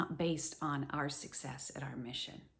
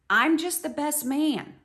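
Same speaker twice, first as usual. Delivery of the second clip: eager best man; 8 semitones higher, 7 dB louder than usual. Acted. John voiced as best man.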